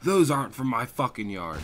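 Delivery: mock-outraged homeowner; angry denial. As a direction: imitate a homeowner loudly denying blame.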